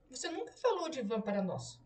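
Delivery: usual speaking voice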